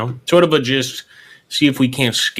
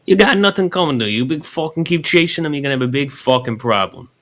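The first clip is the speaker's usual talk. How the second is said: higher, hammed-up Italian mobster accent; 5 semitones higher, 4 dB louder than usual. Shown as a demonstration. Showing him how to threaten, hammed-up Italian mobster. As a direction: push the Italian mobster act up high. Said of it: "Say it like that".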